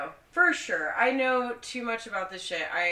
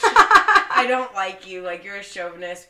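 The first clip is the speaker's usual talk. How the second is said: slow, louder retold outburst; retold yelling; 14 dB louder than usual. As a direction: drag it out loud at him. Quoting herself to ex.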